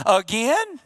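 retold puzzled "again?", rising sharply; incredulous. Church member questioning the pastor's sermon series, incredulous. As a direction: mimic a disbelieving listener.

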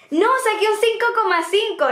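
high-pitched, insistent schoolgirl protest; retold protest. Daughter tells dad her grade, protesting. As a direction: act out an indignant correction, pitched high.

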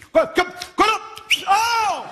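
high stammering shout of recognition; high excited stammer from afar. Retold or imitated. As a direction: call out as if spotting a celebrity.

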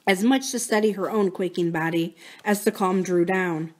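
usual speaking voice

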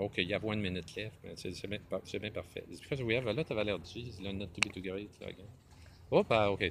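ordinary delivery

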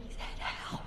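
imitating a shocked child's whisper; hushed, tattling stage whisper; scandalized whisper. Little kid tugging a parent's sleeve in church, scandalized whisper.

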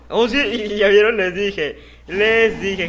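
loud, gloating shout; triumphant told-you-so. Real, not acted. Streamer gloating to viewers, loud triumph. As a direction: rub it in, loud and proud.

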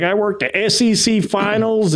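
put-on high Southern accent, proudly. Classmate bragging about his finals, in a Southern accent. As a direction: boast like a Southern big shot, in a Southern accent. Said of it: "He's got a Southern accent".

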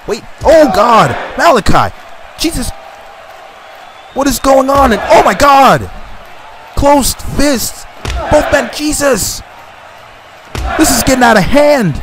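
high, panicked play-by-play; frantic excitement. Real, not acted. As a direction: sound alarmed as the fight escalates. Players throw closed fists.

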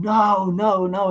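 high, pleading cries of no, retold; crying out no. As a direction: cry out no in a retold vision. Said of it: "And I started crying".

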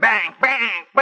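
high, punchy mock gunfire; excited spoken bang noises; imitated gunshots. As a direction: fire off verbal gunshots mid-story. Imitates gunshots while telling the story.

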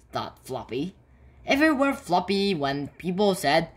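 ordinary delivery, nothing marked

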